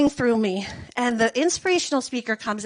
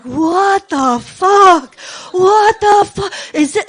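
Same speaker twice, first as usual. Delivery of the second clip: loud, slow stunned outburst, pitched up; shocked disbelief; 6 semitones higher, 10 dB louder, much slower than usual. Retold or imitated. Stunned teacher reacting to the armless speaker, loud disbelief. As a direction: drag out the disbelief, louder.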